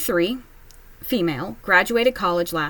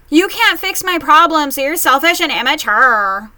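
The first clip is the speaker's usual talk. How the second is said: higher, louder accusing sister; mocking sister; 6 semitones higher, 8 dB louder than usual. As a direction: snap it like an entitled sister. Voicing the angry sister.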